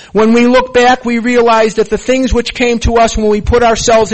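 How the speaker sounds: loud, ringing recitation of the Big Book; loud sermon-style reading of the promises; forceful preaching read. Real, not acted. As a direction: proclaim it to the whole room like a sermon. Loud reading of program text.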